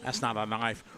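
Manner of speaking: put-on a lightly done Australian accent, dismissive stress on "not"; Crocodile Dundee quote. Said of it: "Okay, Crocodile Dundee".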